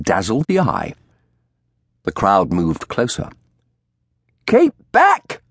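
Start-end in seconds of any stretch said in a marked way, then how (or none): 4.5-5.4 high, loud shrill crone call; shrill old woman. A narrator's reading. Old woman warning off the crowd, shrill. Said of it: "the old woman called in shrill tones".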